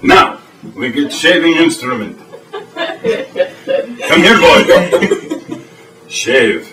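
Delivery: broken-English Russian host, deadpan; mock Eastern European TV host; fake Russian accent. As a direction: play a stern Russian cook in broken English. Boris sketch continues.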